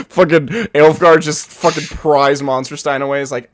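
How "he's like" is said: ordinary talk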